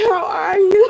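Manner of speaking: ordinary talk, nothing marked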